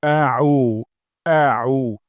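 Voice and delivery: আউ with a hard, firm আ; Arabic a clearly articulated. Shown as a demonstration. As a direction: start আউ with a strong glottal a. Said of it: "এই আ আমরা শক্ত করে উচ্চারণ করব".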